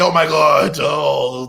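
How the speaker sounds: loud, high whiny imitation of fans; mock complaining. Defender mocking people who couldn't wait, exaggerated complaint. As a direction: mimic overdramatic fans, loudly.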